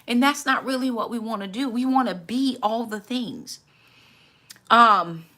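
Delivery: ordinary talk, nothing marked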